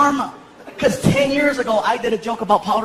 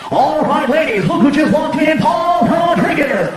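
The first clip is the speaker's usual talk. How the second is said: loud, higher club DJ introduction, drawn out on the name; club DJ announcer; 5 semitones higher, 5 dB louder, slower than usual. Retold or imitated. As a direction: announce a star to the room like a strip-club DJ. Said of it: "and the D J's like".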